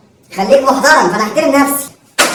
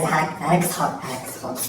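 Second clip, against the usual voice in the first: fading to whisper; 10 semitones lower, 12 dB quieter than usual. Acted. Scolded student promising to focus, fading out.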